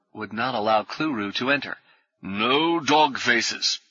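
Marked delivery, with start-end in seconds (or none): 2.2-3.8 slow, pronounced refusal; each word laid down like a rule; stern doorman. Acted. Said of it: "he pronounced".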